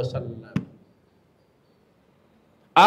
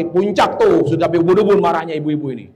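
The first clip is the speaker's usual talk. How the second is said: ordinary talk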